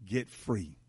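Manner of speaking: normal talking voice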